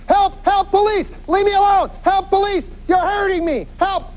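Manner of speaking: high-pitched mock cries for help; yelling for help. Woman being pulled into a car, acted high yelling. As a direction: call out in short, high bursts like a victim. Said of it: "she's yelling".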